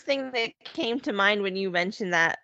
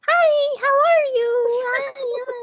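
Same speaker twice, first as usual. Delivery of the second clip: much higher, louder sing-song phone greeting; 5 dB louder, much slower than usual. Retold or imitated. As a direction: pitch way up the second the phone hits your face. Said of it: "I'm just like".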